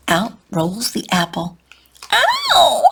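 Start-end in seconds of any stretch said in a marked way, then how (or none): 2.1-2.8 sharp, very high yelp; child's startled yelp; yelled ow. A narrator's reading. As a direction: cry out in pain, high and loud. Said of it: "yells Schubert".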